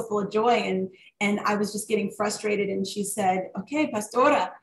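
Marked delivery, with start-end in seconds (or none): 3.6-4.5 put-on Spanish accent. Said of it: "in her Spanish accent".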